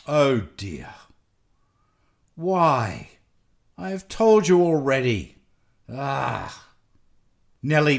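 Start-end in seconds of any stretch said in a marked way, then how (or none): 0.1-6.7 groaning, fed-up patient refusal; weary exasperation. A narrator's reading. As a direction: sigh out the refusal, worn out. Weary doctor groans at repeated pleading.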